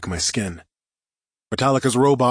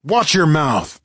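higher, louder warning; sharp, raised-voice rebuke; angry retort; 6 semitones higher, 5 dB louder, slower than usual. A narrator's reading. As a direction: snap back at the insult, loud. Hero snaps back at villain's insult.

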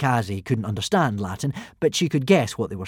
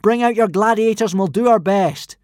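loud, higher, firm call across the arena; 10 semitones higher, 7 dB louder than usual. A narrator's reading. Hiccup calls to Alvin in a loud firm call. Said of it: "Hiccup called out".

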